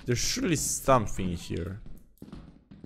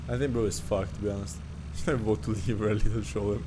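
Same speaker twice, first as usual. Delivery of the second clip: ordinary talk, nothing marked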